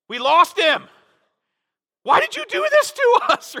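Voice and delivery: high, loud panicked cry; panicked mother. Retold or imitated. Mother who lost her son, panicking loudly. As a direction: shriek it like a frantic mother. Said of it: "the panic in her voice".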